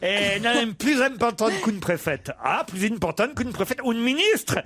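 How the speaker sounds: high, excitable mock Spanish accent. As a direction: play a Spanish policeman with a hammed-up accent. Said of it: "Tu peux, tu peux faire l'accent espagnol".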